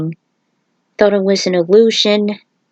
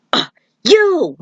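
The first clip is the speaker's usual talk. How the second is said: startled cry; 11 semitones higher than usual. Acted. Stryker shocked to see Cabal.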